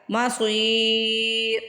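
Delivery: مصیر with a thick ص and long ي; heavy sad, stretched. Shown as a demonstration. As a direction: draw out مصیر slowly. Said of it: "را پہ ساکن لگا دینا ہے". (مصیر میں صاد موٹا، کھینچ کر)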